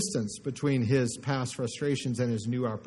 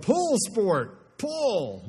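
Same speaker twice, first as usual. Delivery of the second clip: a farmer urging his horse on, mock yelling higher; 8 semitones higher, much slower than usual. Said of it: "Farmer yelled".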